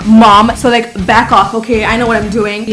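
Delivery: loud, bratty comeback to mom; bratty child. Retold or imitated. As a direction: talk back like an indignant kid. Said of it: "I was a very bratty child".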